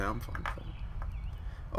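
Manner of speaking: slow, hushed, resigned; whispered reluctant reply. Acted. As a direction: give in under your breath. Quiet reluctant character reply.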